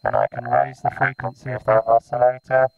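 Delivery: robotic vocoded speech on a buzzy square-wave tone; vocoder voice. Shown as a demonstration. Maker demonstrating his vocoder's oscillator, robotic vocoded voice. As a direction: talk as a robot through a vocoder. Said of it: "listen to this thing vocode".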